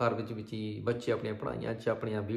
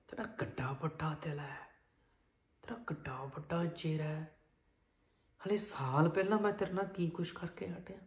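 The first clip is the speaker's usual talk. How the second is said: near-whisper, much softer; acted tearful hush; hushed, overwhelmed; 4 semitones higher, 8 dB quieter than usual. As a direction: barely get the words out, moved and humbled. Weeping son, overwhelmed by mother's love.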